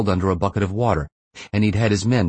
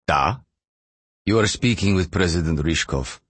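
lightly voiced Russian official; Russian president. A narrator's reading. President to launch-site caller, lightly voiced Russian formality. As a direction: answer as a president, slight Russian accent.

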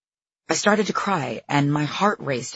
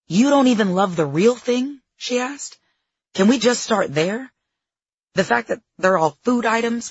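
high, loud disbelief; incredulous coach; 7 semitones higher, 4 dB louder than usual. A narrator's reading. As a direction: scold her in disbelief. Coach scolding her food list.

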